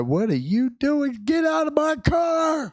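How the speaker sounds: high-pitched mock furious yell; retold yelling. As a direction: yell in exasperation at your sick child. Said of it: "start yelling at her".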